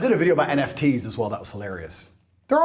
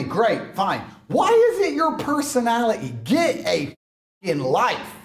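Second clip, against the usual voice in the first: ordinary talk, nothing marked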